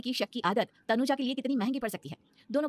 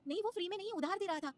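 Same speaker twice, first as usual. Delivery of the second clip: much higher and softer, scared; timid plea; 11 semitones higher, 7 dB quieter than usual. Acted. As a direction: plead quietly, afraid of him. Wife pleads with angry husband. (बहुत ऊँची, धीमी, सहमी हुई सफ़ाई)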